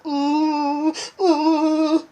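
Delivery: a mock bitter moan, hummed twice; bitter groan. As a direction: hum a bitter groan of torment. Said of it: "responded with a bitter voice".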